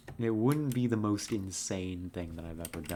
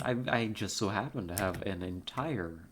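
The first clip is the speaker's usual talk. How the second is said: ordinary delivery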